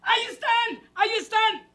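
high, urgent alert call; demonstrated warning cry. Shown as a demonstration. Host showing children how to warn of ghosts, high call. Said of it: "ustedes nos dicen".

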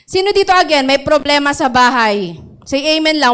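loud, high question to the crowd; preacher calling for amen; rallying call. Real, not acted. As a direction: get them to answer.

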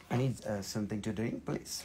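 croaky voice giving out after hours of talking; losing voice. Real, not acted. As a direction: voice nearly gone, barely getting it out. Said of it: "I'm losing my voice".

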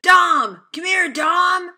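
loud high call across the house. Real, not acted. Calling Dom over to look, loud. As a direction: shout for Dom to come.

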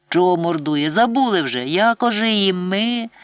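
put-on a boy loudly reminding his mother of the prayer; indignant reminder. A narrator's reading. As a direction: insist she forgot the words.

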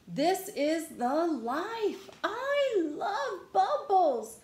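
slow, high, gleeful gushing; blissful delight. Acted. Pigeon loving the bubbles, blissful high gushing. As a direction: revel in it, stretching every word up high.

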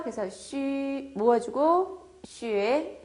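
ordinary talk, nothing marked

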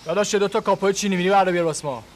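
loud quick call to the waiter. Acted. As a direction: call the waiter over loudly. Calling out to waiter.

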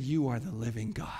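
low, soft and reverent; hushed confession. Acted. Disciples answer who Jesus is, hushed and low. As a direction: say it quietly, as a weighty answer.

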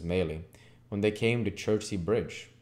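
plain reading voice

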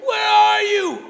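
loud, high calling across water; stretched-out, high movie-hero cry; guy's mock panicked shout. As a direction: yell for a lost partner in hammed-up alarm. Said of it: "Then you hear the guy go".

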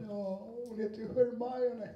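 put-on squeaky kid correcting the reader; mock child. Children who saw the films correct the audiobook reader, high child voice. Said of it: "alla barn som lyssnar".

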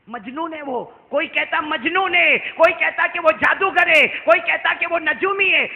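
high, loud preaching that climbs to a shout; impassioned sermon delivery; full-force preaching. Acted. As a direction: preach it out to the crowd, rising to a cry. Listing what people called him.

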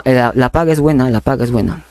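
ordinary delivery

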